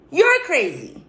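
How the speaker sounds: high, loud exclamation; emphatic verdict. Real, not acted. Vlogger judging someone who stays happy regardless, emphatic verdict.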